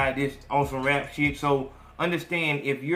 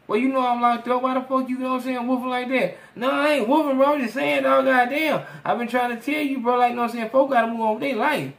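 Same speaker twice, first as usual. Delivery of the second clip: higher-voiced inmate, defensive and aggrieved; jailed friend pleading; 10 semitones higher, 6 dB louder than usual. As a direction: plead your case from behind bars.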